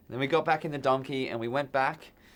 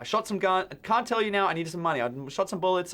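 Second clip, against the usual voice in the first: higher, louder, frantic blurting; panicked babble; 7 semitones higher, 3 dB louder than usual. Retold or imitated. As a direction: blurt it out like a scared kid caught. Panicked babbling to his band.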